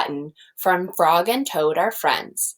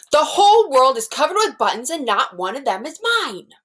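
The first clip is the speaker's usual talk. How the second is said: higher, louder mock tantrum; screamed; 7 semitones higher, 4 dB louder than usual. Toad screaming in a tantrum about buttons. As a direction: let it burst out like a stamping tantrum. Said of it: "jumped up and down and screamed".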